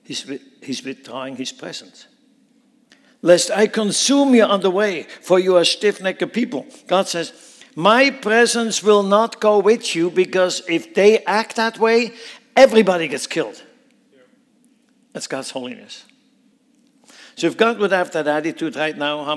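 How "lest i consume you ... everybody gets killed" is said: loud, high, grave pronouncement; stern warning. Acted. God tells Moses he will not go: loud, stern. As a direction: pronounce judgment with full weight. God withdraws his presence.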